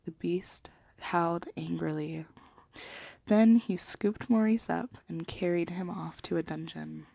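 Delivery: usual speaking voice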